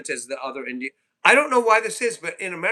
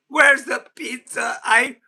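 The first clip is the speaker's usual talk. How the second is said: higher, slow, drawn-out whine; mock whiny demand; 9 semitones higher, 5 dB louder, slower than usual. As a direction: whine for the pizza like a child. Repeating pizza demand, drawn out.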